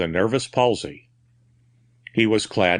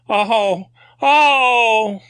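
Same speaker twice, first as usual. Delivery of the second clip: high, loud anguished groans of a soul in agony; agonized groans; 11 semitones higher, 6 dB louder than usual. A narrator's reading. Madman's fury collapsing into tormented groaning. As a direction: let the fury break into agony. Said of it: "The groans which ended the fury".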